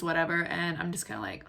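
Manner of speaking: ordinary delivery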